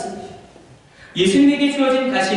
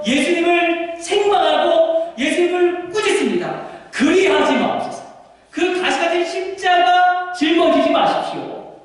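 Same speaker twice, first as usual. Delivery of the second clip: high, slow pleading rebuke of Peter; preaching at full force; 8 semitones higher, slower than usual. Acted. Preacher voicing Peter begging Jesus, pleading and high. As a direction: plead with Jesus not to take the cross, drawn out.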